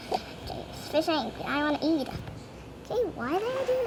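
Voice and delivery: ordinary talk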